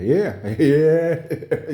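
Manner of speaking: giddy laugh through the words. Retold or imitated. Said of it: "In your mind".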